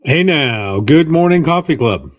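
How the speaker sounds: loud, hearty on-air hello; booming greeting. Real, not acted. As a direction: boom out the morning greeting. Co-host's booming opening greeting.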